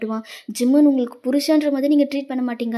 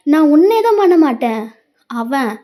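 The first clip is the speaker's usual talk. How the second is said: threatening shout; 3 semitones higher, 7 dB louder than usual. Acted. Husband threatens wife.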